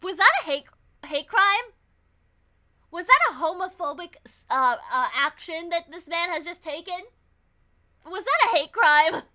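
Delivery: loud, scandalized questioning; mock outrage. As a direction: ham up the shocked accusation.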